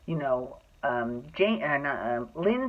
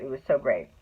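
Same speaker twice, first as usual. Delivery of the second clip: usual speaking voice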